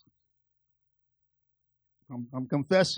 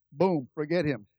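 normal talking voice

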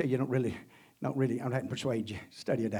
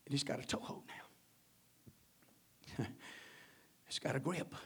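soft, broken whispered sorrow; hushed lament; 6 dB quieter than usual. Real, not acted. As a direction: whisper it like a grieving confession.

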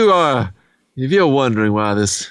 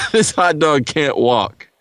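usual speaking voice

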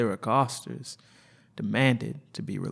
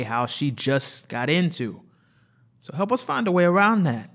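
louder, pressing partner; urgent explaining; 4 semitones higher, 4 dB louder than usual. Acted. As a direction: push the doctor to help.

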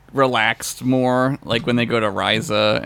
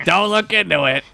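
higher, mock-suspicious warning; shifty cover-up; 8 semitones higher, 3 dB louder than usual. Cohost joining the Romulan bit, mock suspicious warning. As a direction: wave them off like a conspirator.

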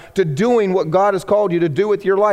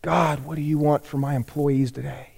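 mock soft prayer; 5 semitones lower, 7 dB quieter than usual. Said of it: "bosses wake up".

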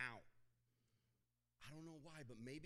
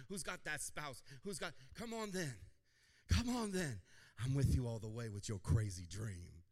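louder preaching build; full-force sermon call; 7 dB louder than usual. Real, not acted.

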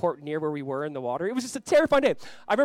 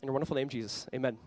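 quiet prayer; 3 semitones lower, 4 dB quieter than usual. Acted. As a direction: pray it softly for the congregation. Leads a prayer.

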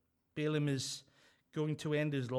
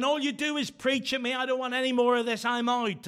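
loud, high angry outburst; husband shouting at his preaching wife as he leaves; 8 semitones higher, 8 dB louder than usual. Retold or imitated. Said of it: "and he was saying".

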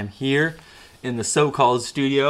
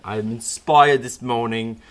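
ordinary talk, nothing marked

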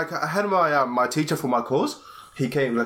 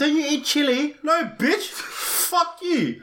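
mock angry outburst; 13 semitones higher, slower than usual. Friend mocks people who call his food spicy, high mock fury. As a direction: spit it out in pitched-up fake fury. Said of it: "So angry".

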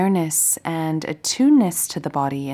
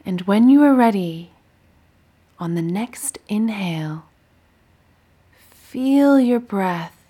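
guided meditation. Acted. Teacher guiding listeners lying down through a body scan, meditative. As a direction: guide them gently through breath and body, unhurried.

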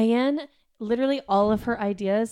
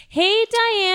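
fake cheerful greeting; 13 semitones higher, 8 dB louder than usual. Greeting the disliked Diane.

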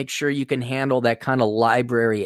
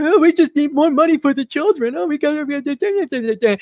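much higher, sing-song mock whine; mocking leftist voice; 12 semitones higher than usual. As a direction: sneer it in a simpering voice. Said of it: "You just".

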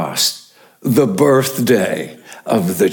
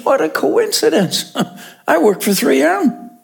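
pitched way up with a laugh in it; much higher chuckling father; 14 semitones higher than usual. Retold or imitated. Second father telling the waiting room, very high and chuckling. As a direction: squeak the line out, tickled.

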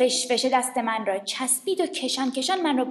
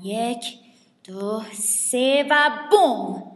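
slow countdown to boom; 4 dB louder, much slower than usual. Acted. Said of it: "counting down to explode".

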